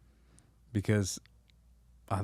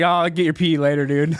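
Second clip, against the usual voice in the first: casual tester; 5 semitones higher, 6 dB louder than usual. Retold or imitated. Usada guy retold leaving.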